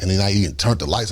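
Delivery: usual speaking voice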